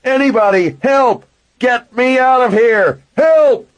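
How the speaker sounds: high, loud desperate screaming; yelling as loud as possible. A narrator's reading. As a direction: scream for rescue at full volume. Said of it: "I yelled as loud as I could".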